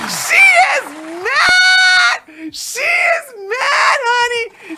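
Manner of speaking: very high, loud gleeful shout; delighted shriek. Real, not acted. Vlogger telling viewers the wife is mad, high gleeful shriek. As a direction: shriek the gossip with glee.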